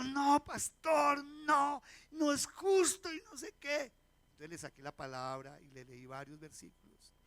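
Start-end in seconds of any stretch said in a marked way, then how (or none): 0.0-3.9 loud, higher, outraged refusal; upset protest. Retold or imitated. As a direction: push back hard, it isn't fair. Leader refuses to forgive, protesting.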